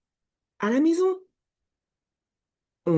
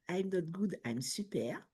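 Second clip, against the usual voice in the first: put-on English accent; 15 dB quieter than usual. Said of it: "Excusez mon accent anglais".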